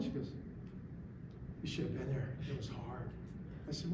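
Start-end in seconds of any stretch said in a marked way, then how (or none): 1.6-3.1 low, very quiet, drained reply; subdued, shaken retelling. Retold or imitated. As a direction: voice the shaken wife softly. Shaken wife comes home from talk.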